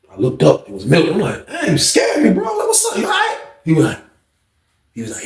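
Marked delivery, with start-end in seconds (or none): 1.5-3.4 high, startled yelp. Retold or imitated. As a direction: jump and blurt it out high. Startled by Milton behind him.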